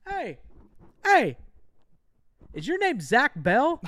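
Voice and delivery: high, loud shouted call across distance; calling out from a guard post, high and loud; retold yell. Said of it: "I hear a guy yell".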